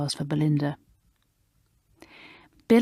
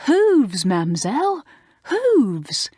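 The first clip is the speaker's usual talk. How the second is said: Hooves stressed high and loud, twice; shocked correction; 7 semitones higher, 4 dB louder, slower than usual. Acted. Bill correcting Mademoiselle's paws to Hooves, shocked. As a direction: hammer home the right word Hooves. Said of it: "quite shocked at Mademoiselle calling them paws".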